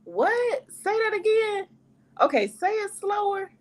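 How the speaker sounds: high-pitched, puzzled request to repeat; baffled asking. Retold or imitated. Listener lost in a thick accent, baffled. Acts out failing to follow an accent.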